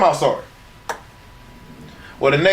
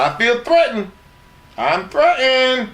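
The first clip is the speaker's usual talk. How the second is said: high, drawn-out mock complaint; hammed-up declaration of being threatened; mock alarm; 11 semitones higher, slower than usual. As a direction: protest it in a raised pitch, stretching the end. Retold reply to a threat.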